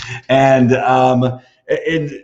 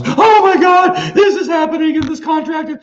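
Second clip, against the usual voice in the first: over-the-top mock office panic; 14 semitones higher, 6 dB louder than usual. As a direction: ham up a staffer whose sky is falling. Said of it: "people are screaming".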